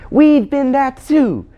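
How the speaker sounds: put-on very high, loud, slow girl's cry; Jill. Jill crying to the Queen about Overworld, insistent.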